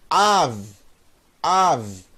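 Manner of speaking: Av as open A closed by a bare V; sounds joined. Shown as a demonstration. Teacher blending letters for students, Av as one syllable. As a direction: say Av as one syllable, no vowel after V. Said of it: "Mais V".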